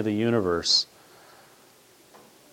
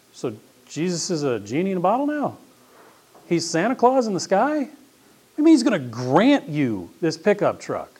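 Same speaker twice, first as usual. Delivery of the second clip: higher, mocking disbelief, slowing at the end; incredulous; 7 semitones higher than usual. Teacher to student about the truck, mock incredulous rise. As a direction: pitch it up in disbelief.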